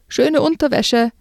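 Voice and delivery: mocking yell. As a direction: jeer across the ice. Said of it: "brüllte er".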